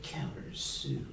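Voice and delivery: slow, soft announcer drawl; hushed, drawn-out game announcer; Halo announcer. Acted. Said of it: "Just get him to say".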